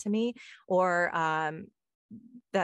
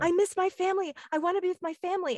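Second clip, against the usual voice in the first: put-on high, tearful child's plea, rushing the second line; pleading child; 12 semitones higher, 4 dB louder, faster than usual. Little boy running to the family he claims, high tearful plea. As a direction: beg like a little boy desperate to go to them. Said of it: "the kid was hysterically sobbing".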